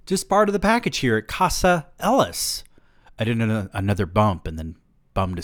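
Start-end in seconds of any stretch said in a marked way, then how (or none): 0.1-2.6 smug joke. A narrator's reading. Smug joking reply.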